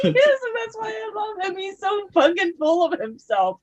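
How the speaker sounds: high-pitched, excited gush. Real, not acted. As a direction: defend your favourite in a delighted squeal. Gushing defence of a favourite character.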